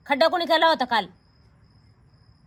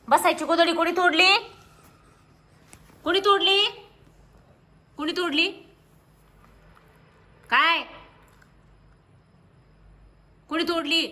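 raised, high-pitched scolding; scolding shout; 6 semitones higher than usual. Real, not acted. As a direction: demand an answer in a high, cross voice. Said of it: "काय ओरडते?". (चढ्या आवाजात रागावून ओरडत)

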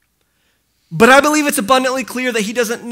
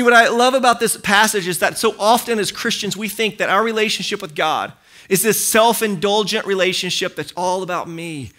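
normal talking voice

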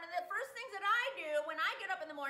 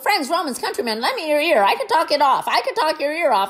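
loud boast; 2 semitones lower, 18 dB louder than usual. Acted. End clip over music, loud.

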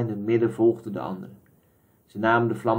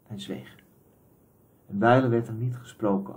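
ordinary delivery

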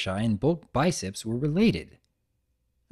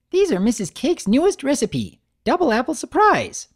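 high, loud, bouncy greeting; Pinkie Pie voice; 13 semitones higher, 4 dB louder than usual. Acted. Pinkie greeting Big Mac at the counter, high and bubbly. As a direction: burst in cheerfully.

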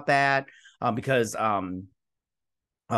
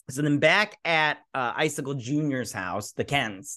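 ordinary talk, nothing marked

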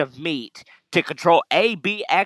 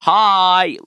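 loud cheery greeting; 6 semitones higher, 7 dB louder than usual. Acted. Voicing professor.

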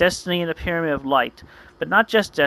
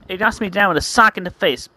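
ordinary delivery

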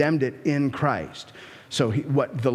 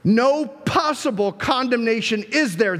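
"No" punched first and hard; emphatic translation; 7 semitones higher, 3 dB louder than usual. Shown as a demonstration. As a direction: lean on "No possible", higher than usual. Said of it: "more literally be translated like this".

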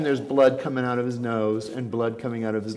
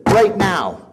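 loud dare; 5 semitones higher, 8 dB louder than usual. Retold or imitated. Retold dare.

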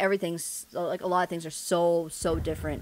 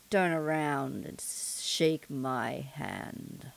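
ominous stranger; much slower than usual. Acted. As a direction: draw each phrase out menacingly, with long pauses. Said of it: "figure out what voice I'm gonna do".

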